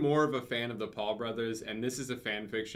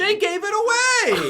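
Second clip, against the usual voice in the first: shocked shout; 10 dB louder than usual. Real, not acted. Outraged shout.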